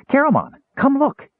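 high-pitched, eager kender shout-out; excited call. A narrator's reading. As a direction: call your friend over, bursting with excitement. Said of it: "he called in excitement".

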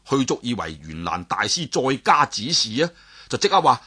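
usual speaking voice

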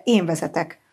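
plain reading voice

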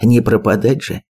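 ordinary delivery, nothing marked

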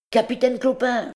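high-pitched shout to the chief; calling out. A narrator's reading. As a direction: call out high to get the captain's attention. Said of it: "cria Andry le Rouge".

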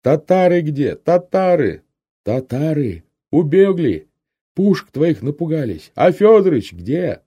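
loud, high shouts to a deaf comrade; half-deaf soldier yelling over ringing ears; deafened shouting. A narrator's reading. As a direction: shout each phrase so the deaf man hears. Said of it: "тоже глухой".

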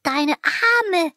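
breathless squeak from a crushing hug; squeezed breathless. Acted. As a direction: choke the words out while being squeezed too tight. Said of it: "weil ich keine Luft mehr bekomme".

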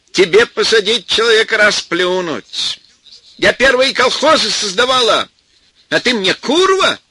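put-on outraged old woman shouting from the doorway. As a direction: yell it out in outrage. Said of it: "громко закричала тёща".

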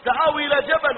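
retold son calling back to his father; defiant son's reply. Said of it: "والولد يقول". (ردّ الابن بتمثيل خفيف وصوت أعلى)

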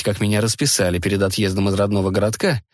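usual speaking voice

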